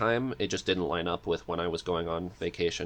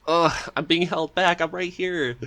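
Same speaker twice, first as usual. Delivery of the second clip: high, louder mock complaint of being held back; exasperated outburst; 9 semitones higher, 5 dB louder than usual. Guest reliving the podcast moment, high mock exasperation. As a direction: whine it like you're stuck waiting your turn. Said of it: "And I was like".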